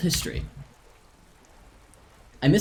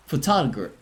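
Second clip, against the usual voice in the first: usual speaking voice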